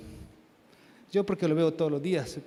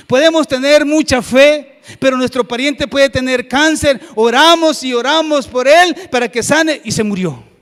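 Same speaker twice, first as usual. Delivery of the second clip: very loud, high, insistent sermon; full-force preaching; 8 semitones higher, 15 dB louder than usual. Real, not acted. Preacher telling congregation of prayers for a sick relative, full-force preaching. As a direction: cry it out to the whole church.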